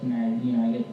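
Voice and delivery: ordinary talk, nothing marked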